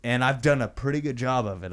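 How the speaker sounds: ordinary talk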